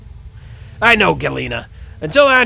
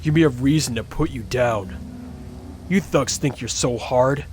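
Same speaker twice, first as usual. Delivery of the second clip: tough cop voice. Acted. Player character threatens.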